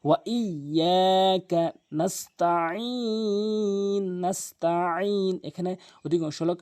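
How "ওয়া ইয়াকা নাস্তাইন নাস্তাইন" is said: "নাস্তাইন" chanted long with throaty ain; correct recitation. Shown as a demonstration. Teacher recites the verse, "নাস্তাইন" with pressed ain. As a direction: stretch "ইয়াকা" and "নাস্তাইন" slowly. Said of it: "আইনের উচ্চারণ হলকের মাঝখান থেকে".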